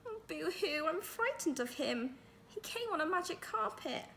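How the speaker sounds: put-on high, whiny little girl's boo-hoo; a child's hammed-up crying, high and slowed; mock crying child. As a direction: play a frightened little girl blubbering. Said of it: "Then Melanie began to cry".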